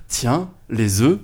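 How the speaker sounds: grand tragic stage delivery. Retold or imitated. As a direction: declaim it like a grand tragedian. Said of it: "leur déclame ces quelques vers".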